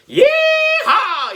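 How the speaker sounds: very high, loud cowboy whoop; yee-haw shout. A narrator's reading. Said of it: "he shouted".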